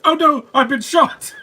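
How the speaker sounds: high, loud hammed-up cry of being shot; mock dying cry. Reacting to a movie scene with a hammed-up shot cry.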